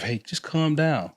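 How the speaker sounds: lightly voiced husband calming his wife. Husband telling his upset wife to calm down, soothing. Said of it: "when I tell my wife".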